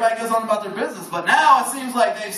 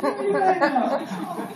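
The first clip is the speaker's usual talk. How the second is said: lovesick phone banter; 8 semitones higher, much slower than usual. Pretend. Lovers' phone banter acted out.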